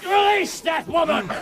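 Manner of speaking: high, loud order ringing out; commanding shout. Acted. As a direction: burst in and command them to let her go. Bursting in on forced wedding.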